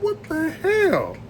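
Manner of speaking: high, slow stunned question; shocked disbelief. Retold or imitated. Seeing the gator vanish into water, slow high disbelief. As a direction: drag it out in disbelief. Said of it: "I said".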